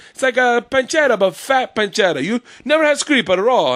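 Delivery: high, excitable Italian husband impression; hammed-up Italian-accented storyteller. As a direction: do a comic Italian accent, pitched well up. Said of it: "Her husband's an Italian guy".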